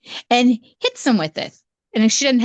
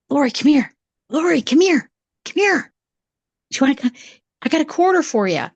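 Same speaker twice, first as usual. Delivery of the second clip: uncle coaxing; 6 semitones higher, faster than usual. Retold or imitated. Uncle coaxing little niece.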